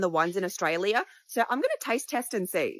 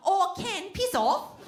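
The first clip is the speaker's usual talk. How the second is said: put-on higher, breezy Australian-accented Barbie; Margot Robbie Australian accent; 9 semitones higher, slower than usual. Comic imagines Barbie telling Ken off in Australian accent. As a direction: brush Ken off like a casual Aussie. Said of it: "Margot Robbie as Barbie".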